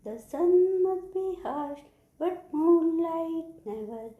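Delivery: high, loud, slow chanting recital; poem recitation. Shown as a demonstration. Teacher reciting the poem for children, high slow chant. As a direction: lift it high and stretch each word. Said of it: "I am going to recite the poem".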